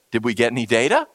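low, even question from the boss; low calm boss voice. Retold or imitated. Pastor voicing Musk asking after a crash, low and calm. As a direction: ask it flatly like the unbothered boss. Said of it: "every time it's the same question".

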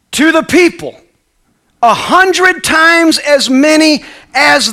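slow, loud, high pleading servant; Joab pleading. Acted. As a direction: plead slowly with the king. Voicing Joab to the king.

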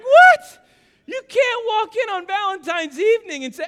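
very high, hammed-up shriek of disbelief; incredulous yell. Husband objects to walk-in seating on Valentine's, incredulous yell. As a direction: squawk it in comic outrage, easing slightly. Retold exasperation at wife's suggestion.

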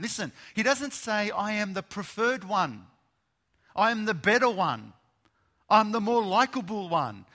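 loud, high, driving sermon voice; preaching at full force. Acted. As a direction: proclaim it, building the fire.

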